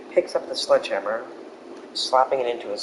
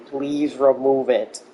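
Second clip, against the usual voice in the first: mild retold yell; 3 semitones higher, 6 dB louder, slower than usual. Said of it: "He's, like, yelling".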